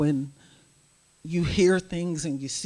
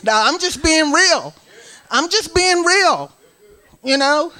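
loud, high, impassioned preaching; fired up; 6 semitones higher, 6 dB louder than usual. Real, not acted. Testifying to the congregation about her anger, loud.

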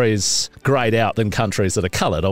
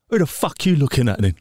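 mock-tough Aussie; 3 semitones higher than usual. Mock aggressive lout again.

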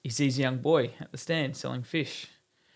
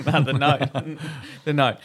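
laughing; 5 dB louder, slower than usual. Real, not acted. Laughing correction about the note.

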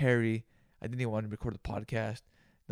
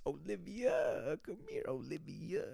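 calling Olivia; 5 semitones higher, 5 dB quieter, slower than usual. Acted.

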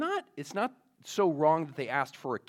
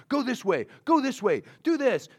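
high, louder, insistent commands; mock shouted orders; 10 semitones higher, 6 dB louder than usual. Voices in the world shouting orders at you, high and urgent. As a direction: imitate voices barking out orders. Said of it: "all these voices in the world shouting".

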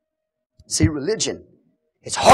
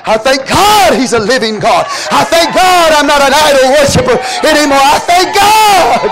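loud, high, stretching out God; full-force preaching; 9 semitones higher, 8 dB louder than usual. Acted.

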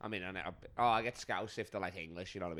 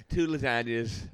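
laughing; 3 semitones higher, 6 dB louder, slower than usual. Real, not acted. Laughing while ordering.

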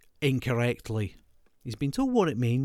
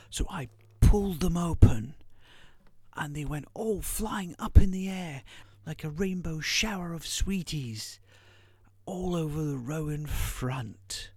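rising hammy excitement fading to a hush; mock dramatic build; 6 semitones higher than usual. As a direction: build it up then drop to a whisper. Dramatic climax of her story.

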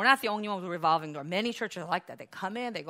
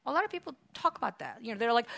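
ordinary delivery

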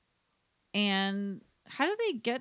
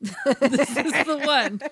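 laughing; 3 semitones higher, 9 dB louder, slower than usual. Acted. Laughing ad line.

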